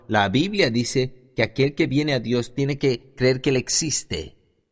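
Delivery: usual speaking voice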